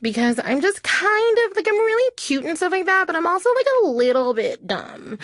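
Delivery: put-on high ditzy college girl uptalk; every line lifts up at the end, cute and airy. Shown as a demonstration. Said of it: "end every sentence with an upward inflection".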